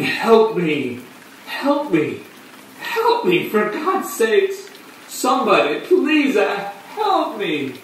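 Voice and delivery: high, desperate cry for help; screaming and blubbering. Acted. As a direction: plead at the top of your range, dragging the last words out. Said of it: "screaming and blubbering".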